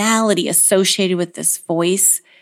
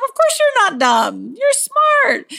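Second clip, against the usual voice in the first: high-pitched, insistent reassurance; eager parental reassurance; 14 semitones higher than usual. Acted. Mom rushes to contradict her kid, eager reassurance. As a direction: jump in with an acted, high-voiced protest.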